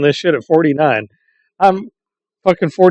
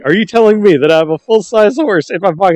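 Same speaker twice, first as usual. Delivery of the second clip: incredulous player; 8 semitones higher, 4 dB louder than usual. Retold or imitated. Retelling sketch, incredulous player.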